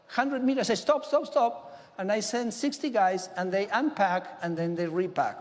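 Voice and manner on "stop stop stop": sharp high call to halt the boat, pitched well up; calling out stop. Retold or imitated. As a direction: shout it like ordering a departing ship to stop.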